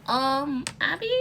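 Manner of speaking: YouTube voice. Retold or imitated. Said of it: "Mister YouTube to be like".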